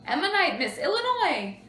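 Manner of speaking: loud, high announcer's proclamation; pageant announcer. Acted. Host announces the pageant winner to the crowd, proclaiming. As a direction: call out the winner to the whole hall.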